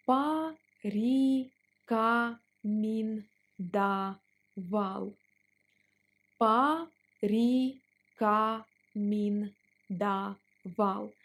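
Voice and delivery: порекомендовал stretched out; slow drill on порекомендовал. Shown as a demonstration. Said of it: "Let's pronounce it slower".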